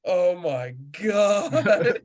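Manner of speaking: dismayed guide. Retold or imitated. Guide hands on head, dismayed.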